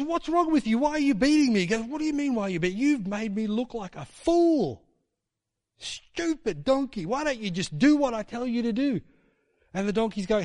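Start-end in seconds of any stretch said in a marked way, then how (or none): 0.0-1.6 high, plaintive donkey voice; talking donkey. Retold or imitated. 1.8-9.0 high, exasperated telling-off; exasperated shout. Retold or imitated. Balaam argues with donkey.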